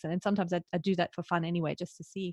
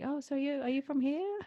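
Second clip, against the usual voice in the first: higher voice of a curious stranger; quoted stranger; 7 semitones higher than usual. Retold or imitated. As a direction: lightly imitate a stranger asking. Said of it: "the guy was like".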